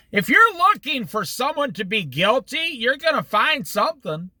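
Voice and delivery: ordinary delivery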